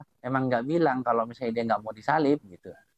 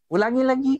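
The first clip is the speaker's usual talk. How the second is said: high-pitched order to repeat; acting out a demanding questioner; imitated insistent demand; 11 semitones higher, 4 dB louder than usual. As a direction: press for it to be said once more. Brother demands the answer be repeated.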